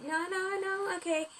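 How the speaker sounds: high-pitched acted protest; lightly voiced, high pleading no; protesting refusal. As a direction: refuse in a high voice, playing the character. Story character refuses in protest.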